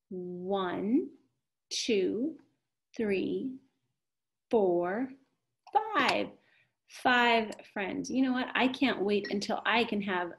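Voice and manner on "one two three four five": counting aloud. Shown as a demonstration. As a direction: count it out one by one. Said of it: "Let's count".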